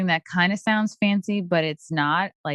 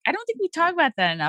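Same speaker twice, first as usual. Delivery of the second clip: normal talking voice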